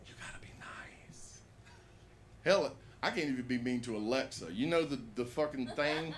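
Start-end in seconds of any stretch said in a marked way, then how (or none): 0.1-1.4 imitated whisper. Retelling his girlfriend's reply.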